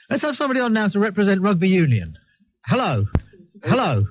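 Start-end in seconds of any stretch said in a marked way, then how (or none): none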